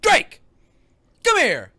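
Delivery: high, loud acted dad yell; mock angry summons. Imitating Will calling his son Drake, mock angry yell. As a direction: call the kid over sharply.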